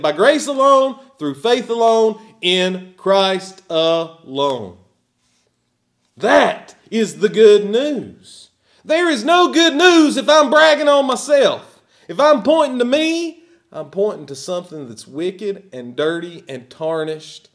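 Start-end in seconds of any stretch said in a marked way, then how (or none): 0.0-4.8 loud, ringing proclamation, slowing at the end; rising sermon cry slowing to a firm close; fervent preaching. Acted. Preaching at full force. 6.2-13.4 high, loud pulpit cry; fervent preaching. Acted. Preaching at full force.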